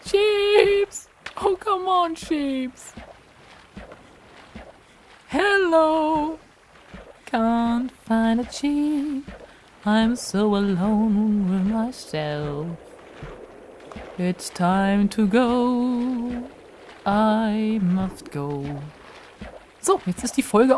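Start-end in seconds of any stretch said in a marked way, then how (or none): 0.0-2.9 high, drawn-out call for sheep; plaintive calling. Pretend. Player calling missing sheep in Minecraft, high plaintive call. 5.3-18.6 very slow, long-drawn vowels; mock-lonely lament. Player lamenting that no sheep is around, mock-lonely and drawn-out.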